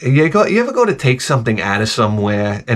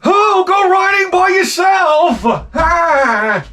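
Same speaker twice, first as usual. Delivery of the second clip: mimicking wife; much slower than usual. Wife storms off telling husband to ride alone, high mock yell. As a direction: shriek it at him like a fed-up wife. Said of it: "your wife comes out ... she's like".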